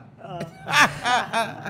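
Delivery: a big, loud laugh, pitched high; mimicking the actor's laugh at the shouting fans; imitated laugh. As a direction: laugh it off the way he did. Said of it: "Polly, han bara så här".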